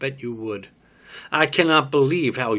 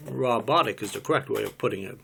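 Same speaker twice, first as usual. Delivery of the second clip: ordinary delivery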